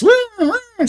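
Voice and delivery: very high mock siren wail; siren button noise. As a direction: sound off like a pressed siren button. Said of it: "I can just press".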